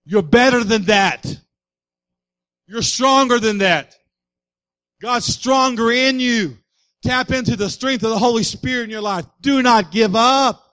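slow, forceful, drawn-out exhortation; urging shout. Acted. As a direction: shout them back onto their feet. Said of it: "friends that are gonna say".

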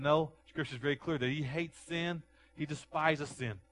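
loud, high, rising preacher's cry; fiery pulpit preaching; preaching at full force. Acted. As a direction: build the sermon to a shout.

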